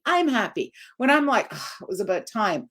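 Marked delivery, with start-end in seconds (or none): 1.5-2.7 lower, softer, annoyed "about time". Shown as a demonstration. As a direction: let the irritation show. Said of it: "I'm annoyed".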